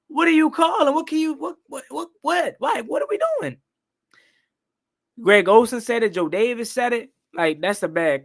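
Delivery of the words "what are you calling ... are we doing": high-pitched, stammering disbelief; incredulous, voice jumping way up; exasperated disbelief. Retold or imitated. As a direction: sputter in protest at a bad call. Exasperated reaction to a bad call.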